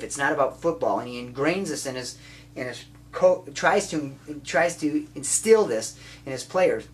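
ordinary talk, nothing marked

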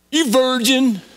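high-pitched mock-angry schoolkid jeer; mad taunt. Angry kid insulting another in seventh grade, mock-mad. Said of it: "they'd get mad at somebody and go".

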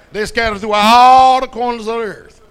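preacher belting out the line; shouted repeat. Acted. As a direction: throw it out to the back row.

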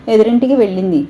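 ordinary delivery

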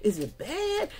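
high, startled questions; mock alarm. Reader startled by a card, high alarmed squeak. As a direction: squeak out worried questions to the spirits. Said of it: "I'm like".

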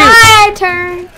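high, loud demanding shout. Real, not acted. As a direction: yell to claim your turn. Grabbing the controller.

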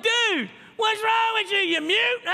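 high, loud jeering taunts from the wall; mocking Jericho guards. Jericho soldiers on the wall taunting the silent marchers, loud high jeering. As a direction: jeer down at them like a bully on the ramparts. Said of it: "Imagine their enemies".

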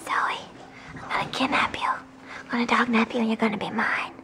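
whispering. Real, not acted. Whispering to the dog.

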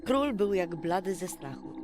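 plain reading voice

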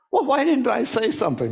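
high-pitched, kicking yourself; exasperated self-reproach. Acted. Person kicking themselves after a conversation, exasperated. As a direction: put on a high voice of hindsight regret.

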